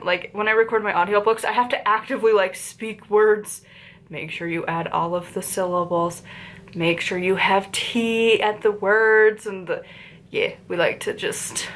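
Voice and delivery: normal talking voice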